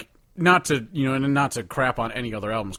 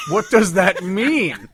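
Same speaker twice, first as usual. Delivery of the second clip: laughing disbelief; 8 semitones higher, 8 dB louder, slower than usual. Real, not acted. Baffled by odd follower.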